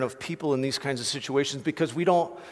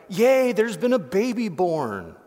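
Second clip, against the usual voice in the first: higher, lightly hammed-up yay; mock cheer; 4 semitones higher, 3 dB louder than usual. Preacher acting out the wrong reaction to the birth, mock cheer. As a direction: cheer it as if it were minor good news.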